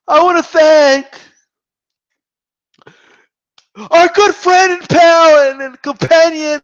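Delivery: mock tearful tribute, loud and high; hammed-up choked-up announcer, dragging out the words; Jim Lampley. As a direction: imitate a man overcome thanking his dear friend. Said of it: "his lips trembling and, and his glasses shaking".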